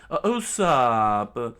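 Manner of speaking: loud, slow call of a friend's name; scream. Acted. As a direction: call out to him in alarm. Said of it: "Chopper screamed out".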